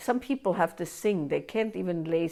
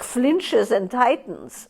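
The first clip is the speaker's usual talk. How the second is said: usual speaking voice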